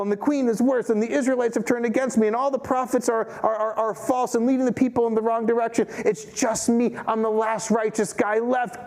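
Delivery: a hint of a self-pitying prophet; worked-up Elijah. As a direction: whine it like a prophet who feels alone. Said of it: "I'm paraphrasing again".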